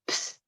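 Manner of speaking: short unvoiced candle-snuff psst; hissed psst. Storyteller imitates cat putting out the candle, short psst. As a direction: imitate a flame being pinched out. He went.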